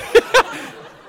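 high, loud, airy burst of laughter; high breathy laugh. Real, not acted. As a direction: break into laughter at the story. Cracking up at own story.

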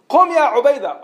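high, loud summons; commanding call. Acted. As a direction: call the next fighter forward. Prophet calls third champion up.

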